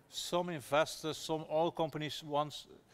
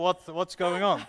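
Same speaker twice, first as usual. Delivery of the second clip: loud, puzzled gossip about friends buying shares; mock surprised friends; 5 semitones higher, 9 dB louder, slower than usual. Friends spotting the Facebook post, loud mock surprise.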